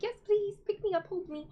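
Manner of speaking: high squeaky cat begging for a cuddle; cat's pleading voice. Retold or imitated.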